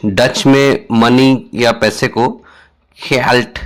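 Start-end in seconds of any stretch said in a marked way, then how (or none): 3.1-3.6 geld said with a throaty first sound, yelt; Dutch word said. Shown as a demonstration. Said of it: "geld इस तरह कहते हैं".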